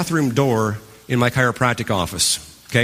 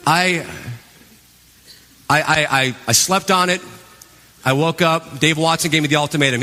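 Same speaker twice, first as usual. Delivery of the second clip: ordinary talk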